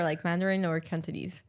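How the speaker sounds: ordinary talk, nothing marked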